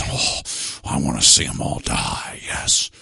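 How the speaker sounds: low, breathy villain whisper; villain gloating in a whisper; sinister whisper. Pretend.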